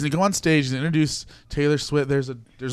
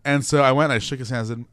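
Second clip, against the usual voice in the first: ordinary talk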